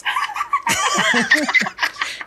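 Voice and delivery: squealing laugh; high shriek of laughter. Real, not acted. Laughing at teasing.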